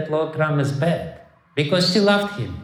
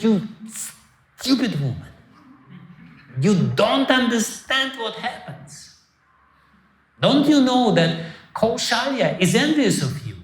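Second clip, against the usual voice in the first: higher, rising contempt; scornful rebuke; 5 semitones higher than usual. Retold or imitated. Maid scorning the queen's trust, rising contempt. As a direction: sneer at her foolishness. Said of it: "And she said".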